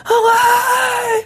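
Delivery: drawn-out, very high scream; imitated scream. Mimics the guy in the trailer, high-pitched scream. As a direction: scream it like a stranded survivor. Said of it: "just screaming".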